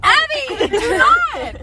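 high laughing plea; laughing protest. Real, not acted. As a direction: protest through giggles, high.

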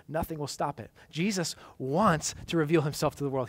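ordinary talk, nothing marked

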